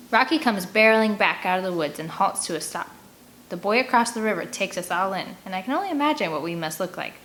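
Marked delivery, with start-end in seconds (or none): none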